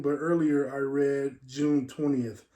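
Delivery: ordinary talk, nothing marked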